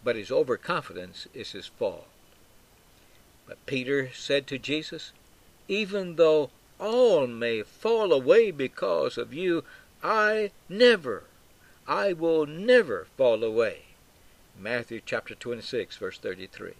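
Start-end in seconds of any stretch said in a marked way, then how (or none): 5.7-13.8 louder, higher declaration with weighty stresses; emphatic. Acted. Peter swearing loyalty to Jesus, emphatic. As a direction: vow it firmly, punching each "never". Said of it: "Peter's emphatic".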